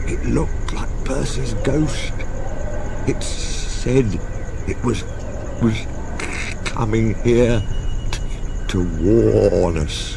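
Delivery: slow, halting, scared telling; shaky frightened voice. Acted. Toby telling Thomas of the ghost, slow and shaky. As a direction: stumble over it, too frightened to get it out. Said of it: "said Toby in a shaky voice".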